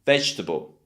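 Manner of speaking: Vegetable as VEJ-tuh-bul, three syllables; Vegetable with no vowel after the "veg"; middle E cut off. Shown as a demonstration. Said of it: "We cut off that E in the middle".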